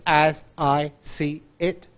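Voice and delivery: slow, unlinked "As I see it", softly; word by word. Shown as a demonstration. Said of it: "we don't speak like this".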